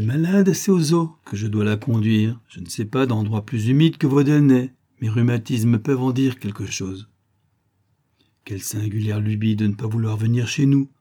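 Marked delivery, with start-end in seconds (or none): none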